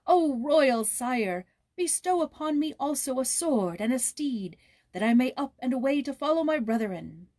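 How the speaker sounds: put-on earnest young prince's plea; pleading prince. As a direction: beg the king on your knees. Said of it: "he cried".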